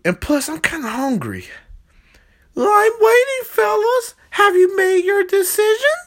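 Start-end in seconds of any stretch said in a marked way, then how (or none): 2.5-6.0 very high, sly cartoon villain; a squeaky scheming hamburger.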